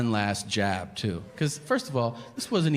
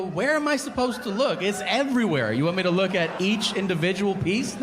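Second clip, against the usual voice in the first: 1 indignant husband; 8 semitones higher than usual. Retold or imitated. Husband's incredulous defense.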